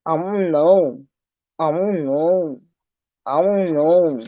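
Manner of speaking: lightly voiced dopey shrug of a reply; a hint of mocking the other woman; mocking her clueless answer. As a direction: parrot her I-don't-know with mild mockery. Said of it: "That's all she gotta say".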